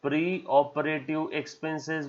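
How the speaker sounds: soft, slow and drawn out; tender, lingering naming of a term; affectionate. Shown as a demonstration. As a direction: say the term lovingly, stretching it out. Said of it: "हम लोग प्यार से".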